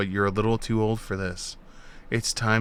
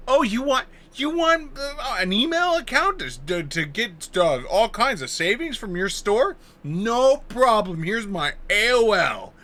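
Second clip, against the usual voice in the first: high, cheery shop-counter voice; mock cheerful customer; 9 semitones higher than usual. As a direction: play an eager shopper handing over junk email. Imitating store clerk exchange.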